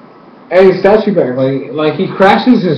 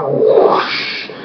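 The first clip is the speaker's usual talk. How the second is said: airy, voiceless rushing noise; imitated whoosh; 5 dB quieter than usual. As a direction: blow out a soft rush of fire. Imitates fire parting.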